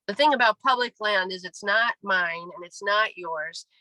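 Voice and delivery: usual speaking voice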